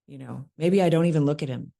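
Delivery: usual speaking voice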